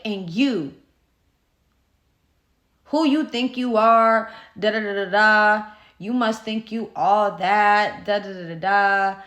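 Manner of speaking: loud mock tirade, trailing into nonsense syllables; imitated cussing out. Neighbor turns on one kid, mock angry cussing out. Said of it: "cussing me out".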